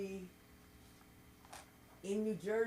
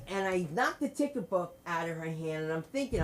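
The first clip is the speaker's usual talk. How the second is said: normal talking voice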